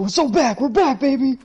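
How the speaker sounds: high, gleeful whooping delivery; mock celebration. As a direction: imitate someone crowing over a comeback. Acting out the same celebration.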